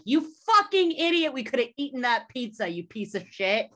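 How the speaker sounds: indignant outburst. Acted. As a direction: berate him like a whole outraged class. Imagined classmates berating the pizza thrower.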